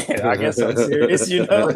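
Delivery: sheepish laughing shrug of a line. Retold or imitated. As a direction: laugh through it as if caught out. Laughing at his own bravado.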